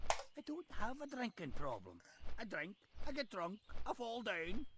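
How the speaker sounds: game dwarf with a light Scottish burr; a proud boast, rolled and jolly; dwarf Scottish accent. Acted. In-game dwarf bragging about drinking, light Scottish burr.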